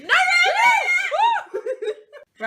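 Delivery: high, loud laughing no; real laughing shout. Real, not acted. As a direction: yell it out through laughter. Book found, laughing protest.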